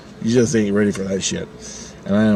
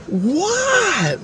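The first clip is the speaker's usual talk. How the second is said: very high, stretched fake astonishment; hammed-up, squealed surprise; overacted drama queen. Drama-class coworker playing along with the test, overacted drama queen. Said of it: "too much acting there".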